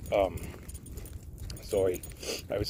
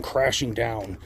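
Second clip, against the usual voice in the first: normal talking voice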